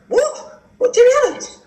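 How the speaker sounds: very high squeaked question; stunned disbelief. Retold or imitated. Cricketer learning who is really calling, squeaky disbelief. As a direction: re-enact shock in a comic high voice. Acting stunned at caller's name.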